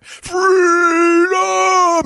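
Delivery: long, high, drawn-out shout; movie battle cry. Retold or imitated. Host re-enacting the film's famous scream, long high shout. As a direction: bellow it like a dying film hero. Mimics film hero's dying cry.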